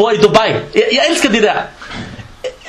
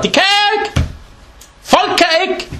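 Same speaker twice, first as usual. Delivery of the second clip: exclaiming; 11 semitones higher than usual. Acted. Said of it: "preacher exclaims".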